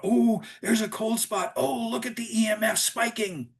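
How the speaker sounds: high, excited mock ghost-hunter voice; hammed-up TV ghost hunter gasping at clues. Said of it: "on T V, you go".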